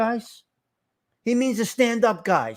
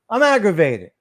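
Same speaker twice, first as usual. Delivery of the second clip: louder, exasperated complaint; aggravated; 5 dB louder than usual. Real, not acted. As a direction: push it out with real irritation.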